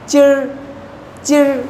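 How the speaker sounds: चिर with the r curled back; चिर drilled as a teacher's example; retroflex r demo. Shown as a demonstration. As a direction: say चिर, tongue tip curled for the r. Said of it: "आर की sound आपको सुनाई दे रही है".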